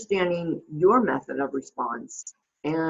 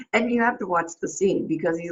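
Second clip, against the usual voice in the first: ordinary talk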